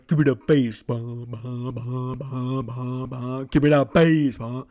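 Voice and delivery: mock techno beat with bass drops; hammed-up techno beatbox; imitating techno bass. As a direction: chant the thumping bass like a club track. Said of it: "Techno is just literally".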